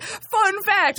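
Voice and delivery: high, playful fun fact announcement; cheery announcement. Retold or imitated. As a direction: sing it out like a teaser. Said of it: "every year I'm like".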